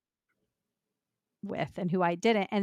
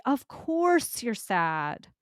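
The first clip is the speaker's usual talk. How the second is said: gentle validation; 3 semitones higher, much slower than usual. Shown as a demonstration. Host models what to tell a grieving parent, slow and tender. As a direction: say it slowly like you mean it.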